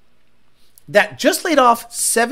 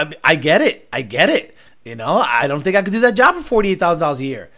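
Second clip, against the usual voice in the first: normal talking voice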